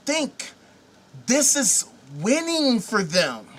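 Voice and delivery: ordinary delivery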